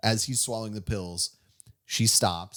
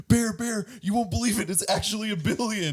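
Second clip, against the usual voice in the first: mock freak-out, much higher; frantic excitement; 12 semitones higher than usual. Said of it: "he's like".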